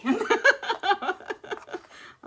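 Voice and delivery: long, high, loud laughter; giddy laugh. Real, not acted. Streamer giddy over stars party, high loud laughter. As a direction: burst out laughing, overjoyed.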